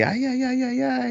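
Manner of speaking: very high-pitched robot catchphrase; sustained high mock wail of alarm; Alpha. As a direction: squeal it high, imitating a panicky little robot. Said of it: "just say".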